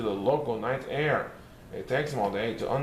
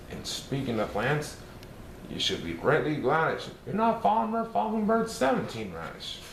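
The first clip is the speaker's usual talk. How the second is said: ordinary delivery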